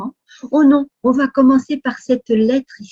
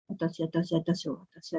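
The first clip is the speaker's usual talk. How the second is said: much softer, lower attention attention, near a whisper; hushed suspense; 6 semitones lower, 13 dB quieter, much faster than usual. Shown as a demonstration. Teacher hushing the class before the next clue, softly. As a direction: draw the kids in with a hushed warning. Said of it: "teacher building suspense for children".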